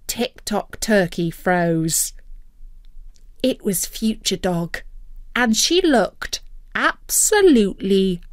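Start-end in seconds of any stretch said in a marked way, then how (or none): none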